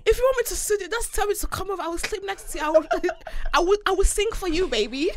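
high playful flirt; flirty teasing. Real, not acted. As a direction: tease sweetly.

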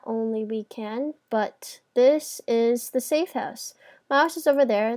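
usual speaking voice

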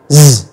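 "z" as a low, steady buzz; voiced Z. Shown as a demonstration. Teacher showing the vibrating sound, voiced Z. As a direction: buzz "z" with vibrating vocal cords. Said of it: "các bạn rung lên nó ra âm giờ".

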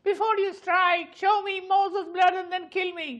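very high, slow dramatic plea; high film character voice. Shown as a demonstration. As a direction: plead slowly in a put-on high voice. Mimicked film character pleading.

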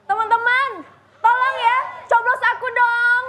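loud rally speech to the students; campaign speech. Pretend. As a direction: call out to the crowd for votes. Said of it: "kasih pidato ke teman-teman".

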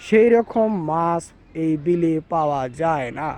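ordinary talk, nothing marked

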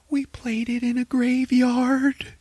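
high, slow dismayed voice; mock whiny realisation. [name] telling mom the awful truth, high and slow. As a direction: drag it out in high horror.

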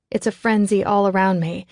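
plain reading voice

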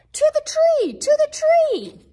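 high, loud excited call; excited cry. Acted. Reader cheers the dogs racing to the tree, high excited call.

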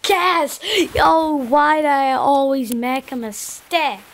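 loud frustrated yell; excited outburst. Real, not acted. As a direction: shout it out in gaming frustration. Caught in the game.

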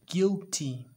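exaggerated guilty cry; hammed-up guilt. Shown as a demonstration. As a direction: act guilty for the class. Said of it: "¿Qué estoy?".

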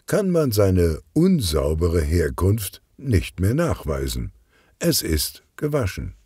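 usual speaking voice

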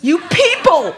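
high, loud exasperated cry; sputtering outrage. A narrator's reading. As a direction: explode at the whole family. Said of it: "Christina was sputtering".

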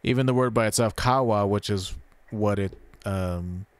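ordinary talk

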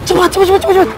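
high, rapid-fire urging; breakneck, high-pitched hurry-up; urgent hurrying. Acted. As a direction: hustle your friends away in a panic. Urging friends to flee.

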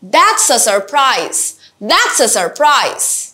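slow exclamations stressing the first word then falling; teacher drills slow falling exclamations; exclamation intonation. Shown as a demonstration. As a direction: drag each exclamation slowly and let it fall. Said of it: "starting part of the sentence should be louder".

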